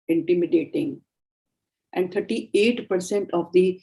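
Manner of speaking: usual speaking voice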